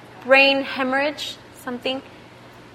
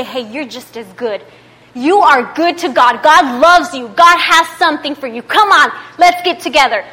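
loud, raised preacher voice; fired-up preaching; 4 semitones higher, 8 dB louder than usual. Real, not acted. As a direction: urge the congregation on with force. Preaches at full force.

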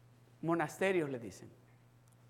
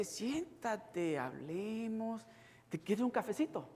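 much softer mock calming; soothing; 4 semitones higher, 5 dB quieter, slower than usual. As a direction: hush them down gently.

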